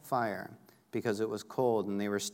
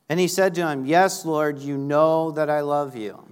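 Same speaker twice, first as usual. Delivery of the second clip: loud, higher declaration; raised, projected pulpit voice; emphatic scripture reading; 5 semitones higher, 9 dB louder than usual. Acted. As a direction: proclaim Peter's answer to the congregation. Peter's answer read out emphatically.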